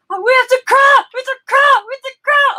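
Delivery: high loud repeated shouts; mock shouting. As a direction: hammer the line like a stuck loop. Mimics husband's shouted edit loop.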